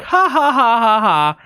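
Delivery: high, put-on laugh; mock neighbor laugh. Storyteller mimics neighbors amused by their cat, high fake laugh. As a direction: imitate a delighted laugh, starting high.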